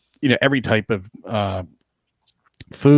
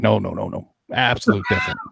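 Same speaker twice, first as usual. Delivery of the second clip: ordinary talk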